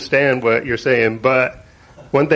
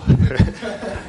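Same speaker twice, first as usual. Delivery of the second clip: nervous laugh. Real, not acted. Laughs about being in front.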